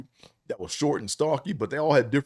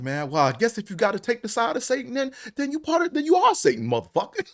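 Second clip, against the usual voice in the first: much higher and climbing, hammed-up fury; mock preacher rant; 11 semitones higher than usual. Preacher cusses out a caller siding with Satan, hammed-up rising yell. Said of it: "He'd be like".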